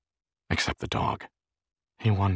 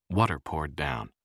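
plain reading voice

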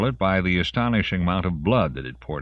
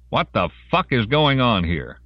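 raised, higher commanding question; demanding; 6 semitones higher, 4 dB louder than usual. A narrator's reading. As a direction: demand an explanation. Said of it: "Intoko demanded".

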